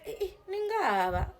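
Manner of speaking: a local gasping at the strangers, pitched high; surprised locals. Retold or imitated. Bar regulars stunned by the newcomers, high surprise.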